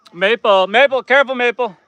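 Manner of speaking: high, loud urgent calls; calling a warning. Real, not acted. Owner warning goat tangled in the cord, loud urgent call.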